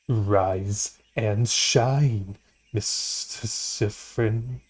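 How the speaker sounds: very slow, drawn-out G-Man voice with odd timbre; G-Man impression. Streamer reading game lines to sleepy Siffrin, slow G-Man voice. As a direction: stretch every word like a creepy G-Man. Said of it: "my worst ever attempt at a G-Man impression".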